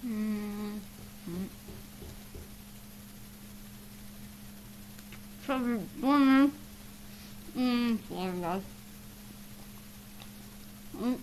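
cheeks stuffed full. Real, not acted. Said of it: "We're gonna try to do Chubby Bunny".

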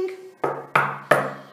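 startled unvoiced gasp; sharp gasp. A narrator's reading. Dudley startled by the knock, gasping. Interrupted song.

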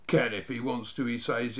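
ordinary delivery

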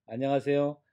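normal talking voice

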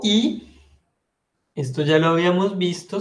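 usual speaking voice

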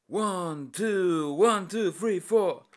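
high, loud band count-in. Acted. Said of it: "laut die Eröffnungsnummer anzählte".

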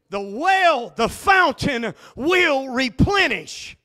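loud, ringing preacher's climax; shouted revival preaching; full-force preaching. Acted. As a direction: preach it hard to the congregation.

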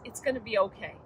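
gentle, quiet hushing; a tender, hushed hug in words; soft reassurance. Retold or imitated. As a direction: comfort a child softly. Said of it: "God comes along and wraps me up".

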